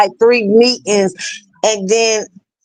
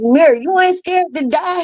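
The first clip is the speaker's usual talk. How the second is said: disbelieving question; 8 semitones higher than usual. Retold or imitated. Asking son in disbelief.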